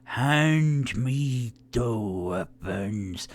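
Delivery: slow, drawn-out dwarf voice; Thornan the dwarf. Acted. Dwarf putting coins on the bar, slow surly demand. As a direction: draw each word out as a surly dwarf. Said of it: "and goes".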